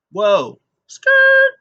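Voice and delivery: high loud "whoa" and screech; imitated screech halt. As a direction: mimic brakes screeching to a halt. Sami halting his entrance music.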